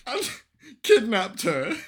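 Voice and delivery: high-pitched, helpless laughing retelling; barely getting the story out through laughter; laughing while talking. Real, not acted. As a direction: crack up as you tell it. Cracking up while recounting the scene.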